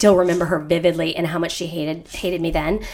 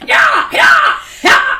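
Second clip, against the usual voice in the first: much higher, loud cowgirl holler; mock rider yell; 8 dB louder, much slower than usual. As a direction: whoop it like riding full speed. Imagined barrel rider yelling at horse.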